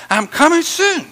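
high, loud exclamation; Jesus' excitement. Acted. Preacher showing the congregation Jesus' excitement, loud and high. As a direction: cry it out with sudden excitement. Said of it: "This is Jesus' excitement".

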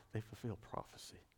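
dropped to a hush; whisper. Real, not acted. Preacher hushing his voice on fulfilled prophecy. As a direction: breathe it out softly. Hushed emphasis.

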